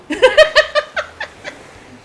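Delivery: high, loud burst of laughter; high rippling laugh. Real, not acted. Laughs after stating age.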